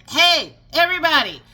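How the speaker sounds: high, loud shouted announcement; street corner announcer. Retold or imitated. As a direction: call out to the whole street. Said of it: "Amazon is not standing on the corner saying".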